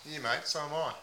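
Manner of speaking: a lightly put-on Aussie bloke accent, mate on every line. Host playing a heartbroken meth packer in a mild put-on Aussie accent. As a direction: ham up the ocker Aussie, leaning on mate. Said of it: "put on a few different voices".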